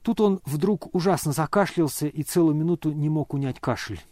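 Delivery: ordinary delivery, nothing marked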